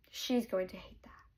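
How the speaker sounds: hushed conspiratorial whisper; whispered aside. Real, not acted. Reader whispering to viewers about the heroine. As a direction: lean in and whisper the prediction.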